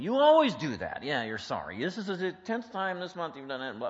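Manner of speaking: loud, high exasperated accusation; accusing spouse. Acted. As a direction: throw old offenses back, voice jumping up. Acted spouse's angry accusation.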